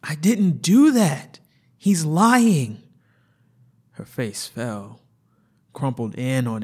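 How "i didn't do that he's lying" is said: high, loud anguished protest; desperate denial. Acted. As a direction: cry out the denial. Patient denies, distraught.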